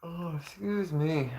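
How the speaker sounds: slow words mid-yawn. Real, not acted. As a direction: say it while yawning. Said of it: "I'm yawning".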